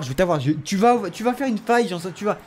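ordinary talk, nothing marked

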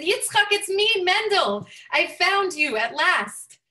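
overjoyed reunion greeting; excited reunion call. Acted. Mendel finally finds his friend.

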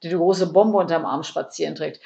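ordinary delivery, nothing marked

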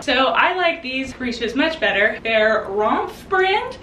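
ordinary talk

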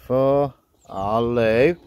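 ordinary delivery

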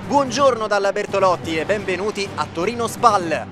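loud, lively play-by-play; sports commentary. Acted. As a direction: call the highlights like live TV. Match highlights commentary.

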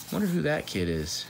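ordinary delivery, nothing marked

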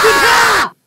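loud startled curse after a fright; startled yell. Real, not acted. Player after a jump scare, yelling in fright. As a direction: jump out of your skin.

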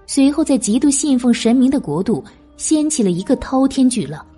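ordinary delivery, nothing marked

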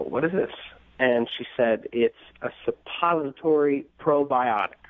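ordinary talk, nothing marked

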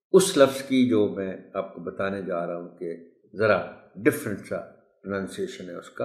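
usual speaking voice

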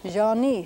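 "Jean-ni" with a nasal first vowel and stress on "ni"; Jean-ni demo. Shown as a demonstration. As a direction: say "Jean-ni" slowly, stressing the second syllable. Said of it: "it's pronounced ni".

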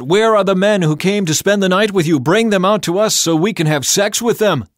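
loud, high angry yelling; shouting mob. A narrator's reading. The men of Sodom yelling at Lot outside his house, loud and angry. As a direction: bellow it out as a menacing crowd. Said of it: "They shouted to Lot".

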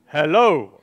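low hello with a laugh; deep hello for Joseph. Shown as a demonstration. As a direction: drop into a low man's greeting. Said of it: "you say".

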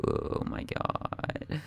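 slow breathless whisper; terrified whisper. Real, not acted. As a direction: whisper it, frozen with fear. Surrounded, tense.

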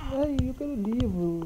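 high, slow whine; mock whining; whiny plea. Whining for book.

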